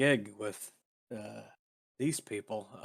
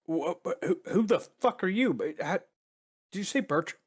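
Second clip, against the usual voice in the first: higher, stammering shock; startled confusion; 8 semitones higher than usual. Acted. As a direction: caught off guard, demand answers. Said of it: "be like".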